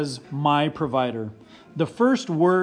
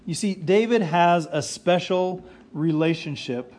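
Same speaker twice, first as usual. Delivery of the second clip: usual speaking voice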